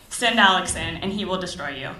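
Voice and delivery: ordinary delivery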